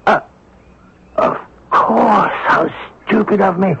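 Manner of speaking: high, tearful self-reproach; crying realisation. Acted. Inspector crying over his own stupidity, tearful. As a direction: break into tears at your own blunder.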